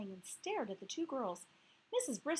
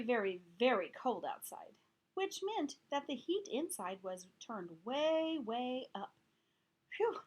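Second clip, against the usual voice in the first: usual speaking voice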